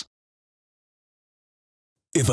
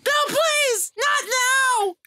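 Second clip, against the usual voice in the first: very high falsetto plea; screaming female voice; much slower than usual. Pretend. Gamer faking a girl pleading with a man, screaming falsetto. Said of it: "In my female voice".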